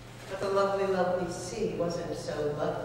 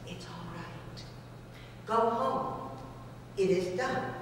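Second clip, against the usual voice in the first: very soft, calm reassurance; reassuring fish; 8 dB quieter than usual. Acted. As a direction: settle him gently.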